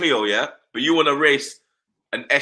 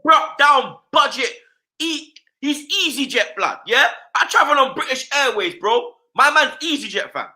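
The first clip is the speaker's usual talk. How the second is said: higher-pitched, fired-up football rant; worked-up rant; 7 semitones higher than usual. Real, not acted. Fan tells his co-host Mustafi is budget, heated rant. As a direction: wind yourself up, scornful and animated.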